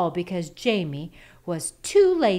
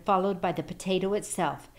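ordinary delivery

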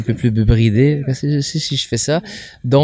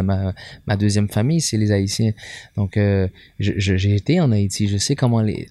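normal talking voice

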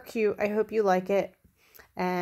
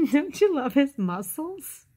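plain reading voice

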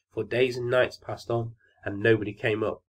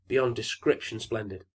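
plain reading voice

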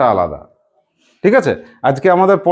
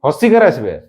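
ordinary talk

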